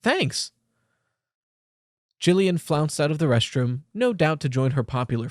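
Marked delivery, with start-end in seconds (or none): 0.0-0.5 high, stunned little thanks; shocked thanks. A narrator's reading. Girl stunned by a compliment, high shocked thanks. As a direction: barely get the word out in shock. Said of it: "so shocked she could barely manage to say".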